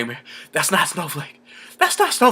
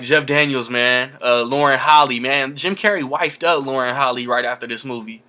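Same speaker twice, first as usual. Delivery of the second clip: normal talking voice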